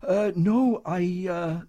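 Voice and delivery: halting, unsure hesitation; a shy lad losing his words; stammering. Acted. Young soldier stammering at the comedian. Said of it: "the young man stammered".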